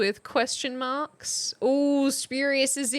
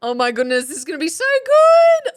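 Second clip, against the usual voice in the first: much higher and louder, fast; thrilled; 3 semitones higher, 11 dB louder, faster than usual. Real, not acted. Streamer can't wait to read viewers' sentences, thrilled. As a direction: burst with anticipation. Anticipating reading chat.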